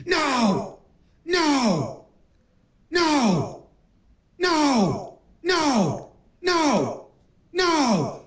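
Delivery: high, falling cries of despair; hammed-up sobbing howl, sliding down; mock anguished wail. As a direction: dub a distraught coach wailing each word. Dubs the screaming, stripping coach.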